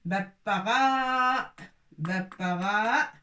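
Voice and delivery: "Bapara, bapara" drawn out, syllable by syllable, no sound on the silent alif; teacher sounding out "Bapara" slowly; slow model pronunciation. Shown as a demonstration. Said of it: "soukoun mayit sur l'alif".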